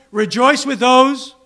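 preaching exhortation. Real, not acted.

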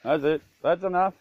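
normal talking voice

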